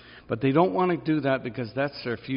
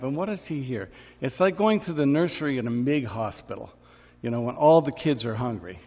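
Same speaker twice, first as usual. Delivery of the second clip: ordinary delivery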